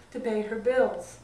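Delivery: ordinary delivery, nothing marked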